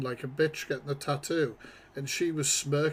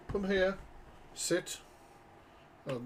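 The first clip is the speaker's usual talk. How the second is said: ordinary talk, nothing marked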